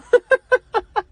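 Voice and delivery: sudden high, loud laugh; high burst of laughter. Real, not acted. Laughing at her own story of calling on dead relatives, high laugh. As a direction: laugh out at yourself.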